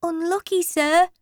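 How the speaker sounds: a high, small pupil's voice; a cheeky aside from the back. Acted. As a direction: pupil cheekily commiserating with the teacher. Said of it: "A small voice said".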